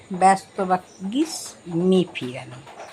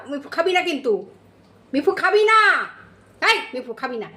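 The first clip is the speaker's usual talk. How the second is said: scolding the cat; 6 semitones higher, faster than usual. Real, not acted. Scolding the cat not to eat.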